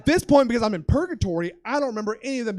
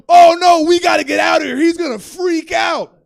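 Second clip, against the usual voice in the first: loud, high alarmed outburst; panicked shout; 7 semitones higher, 16 dB louder than usual. Retold or imitated. Friends seeing the promoter take acid, panicked shout.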